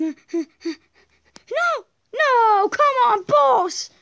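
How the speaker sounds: furious shout at the game; angry yell. Real, not acted. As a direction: yell at the boss in frustration. Losing to boss.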